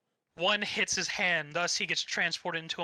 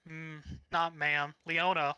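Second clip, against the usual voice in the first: ordinary talk, nothing marked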